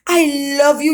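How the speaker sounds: hammed-up loud love line. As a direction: swell it to a mock passion. Letter read, climax.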